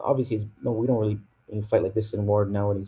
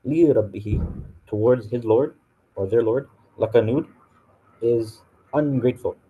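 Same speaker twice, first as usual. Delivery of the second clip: ordinary talk, nothing marked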